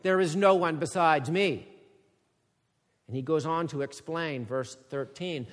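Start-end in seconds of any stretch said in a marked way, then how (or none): 0.0-1.7 louder, emphatic, with stress on no; God's declaration. Acted. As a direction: set it firmly against Babylon's boast. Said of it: "God gives a marked contrast".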